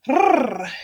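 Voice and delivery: quick, loud trilled rrr; imitated whirring noise; rolled rrr noise. Shown as a demonstration. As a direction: trill an rrr like a speeding motor. Imitates fast shading noise.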